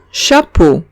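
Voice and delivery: chapeau said the French way, sha-POH. Shown as a demonstration. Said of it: "Siguiente palabra".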